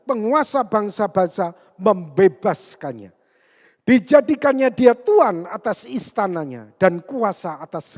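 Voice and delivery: loud, forceful Bible declamation; ringing pulpit reading of a psalm; preaching scripture reading. Acted. Reads out the psalm passage.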